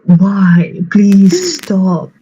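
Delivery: hammed-up exasperated begging; retold exasperated yelling. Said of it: "I yelled at the baby".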